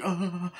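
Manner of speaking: high, quavering "ah"; mock stabbed cry. Retelling Michael stabbing her, high mock cry.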